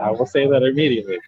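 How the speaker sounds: normal talking voice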